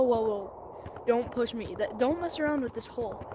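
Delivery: ordinary delivery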